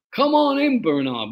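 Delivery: imitating an American host inviting him in; American accent. American soldier inviting salesman Bernard in, American accent. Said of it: "in his American accent".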